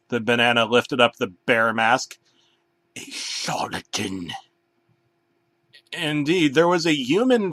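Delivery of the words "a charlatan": low dramatic reveal. Acted. Unmasking the bear.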